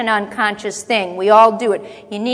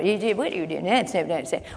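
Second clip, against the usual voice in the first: ordinary talk, nothing marked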